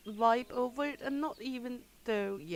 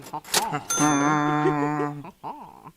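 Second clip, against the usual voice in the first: mock French laugh; 5 semitones lower, 12 dB louder than usual. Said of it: "I like they are going".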